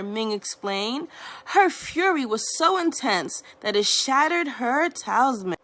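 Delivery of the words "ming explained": plain reading voice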